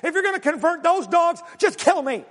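high, loud, exasperated plea; ticked-off Jonah. Retold or imitated. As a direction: beg God to end it, fuming. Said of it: "he is ticked off".

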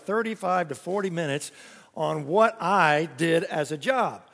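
usual speaking voice

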